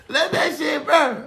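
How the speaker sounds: high, slow, laughing yell of the line; laughing high shout. Retold or imitated. Cracking up while echoing the careless fireman, high laughing shout. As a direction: squeal it out through laughter.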